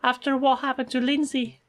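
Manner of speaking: high, fussing lady's voice; sweet coworker. Acted. Coworker hoping it's good news, high fussing voice.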